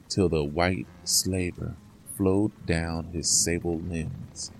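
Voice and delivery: ordinary delivery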